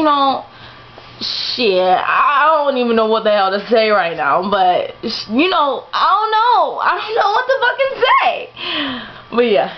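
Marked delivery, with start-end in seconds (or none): 5.9-9.4 high-pitched laughing through the words; cracking up so hard the voice goes up high; laughing fit. Real, not acted. Laughing helplessly to viewers late at night.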